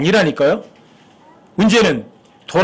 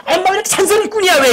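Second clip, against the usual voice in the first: high-pitched, whiny retort, acted out; put-on exasperated protest; mock complaint about nagging; 11 semitones higher than usual. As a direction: gripe in a high voice, imitating a family squabble. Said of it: "그러면 엄마가 그러고 그러지".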